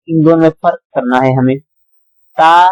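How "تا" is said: تا said light and thin. Shown as a demonstration. Teacher contrasts the thin تا with the thick قا. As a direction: keep تا light. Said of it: "باریک پڑھا جائے گا".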